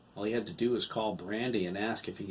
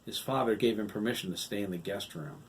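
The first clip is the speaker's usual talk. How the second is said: ordinary delivery, nothing marked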